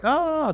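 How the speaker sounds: high-pitched mock wail of dismay; hammed-up anguished oh. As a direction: let out a high, pained "oh". Despairing reaction to mom's advice.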